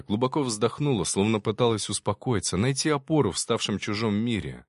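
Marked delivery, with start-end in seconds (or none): none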